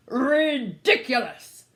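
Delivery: king scoffing at the story; slow royal scoff. A narrator's reading. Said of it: "The king laughed".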